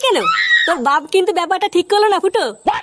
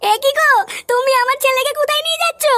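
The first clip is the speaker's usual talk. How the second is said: higher, startled mother's cry; alarmed mother; 5 semitones higher than usual. Acted. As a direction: gasp out as a panicked mum. Mutter erschrocken.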